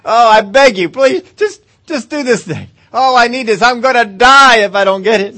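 loud, high, desperate hammed-up begging; begging God, loud and high, hammed up; mock begging. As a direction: beg like your life depends on it. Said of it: "begging God".

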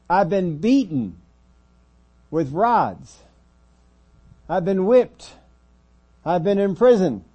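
high, loud preacher's cry; shouted, dramatic list of ordeals; impassioned testimony. Acted. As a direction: hurl out each hardship at full force. Preacher voices Paul listing hardships.

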